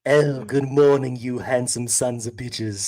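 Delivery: slow, drawn-out put-on voice; better character voice. Host redoing the country request in a better voice, slow put-on voice. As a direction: stretch the greeting out in a full character voice. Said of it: "do it in a better voice".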